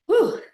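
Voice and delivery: loud, high relieved whew; whew exhale. Real, not acted. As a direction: blow out a big breath. Exhale after hard set.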